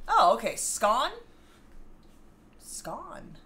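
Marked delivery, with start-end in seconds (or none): 0.6-1.1 "Scone" with a short o, rhyming with gone; British pronunciation. Shown as a demonstration. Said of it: "we say it like bon". 2.6-3.4 "Scone" said softly as "skon"; quiet, rising "Scone" with a short o; British pronunciation. Shown as a demonstration. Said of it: "we say it like bon".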